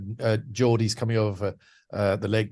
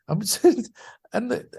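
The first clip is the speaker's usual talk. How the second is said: ordinary delivery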